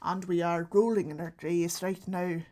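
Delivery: mock Irish accent, monotone disappointment; Irish great-grandparent. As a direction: disown them in a tight-mouthed Irish accent. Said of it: "Irish is my great grandparents".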